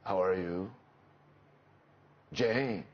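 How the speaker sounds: a touch of hoarseness from allergy; hoarse voice, retold. Shown as a demonstration. Actor recalling how his sick voice found the character, hoarse. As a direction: imitate a voice that suddenly came out hoarse. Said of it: "my voice came out. It was like".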